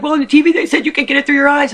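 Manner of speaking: high, fast, loud worried sister voice; imitating sister. Sister insisting about the TV news, high worried voice. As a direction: rattle it off like the anxious sister. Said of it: "And she's like".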